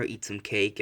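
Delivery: ordinary delivery, nothing marked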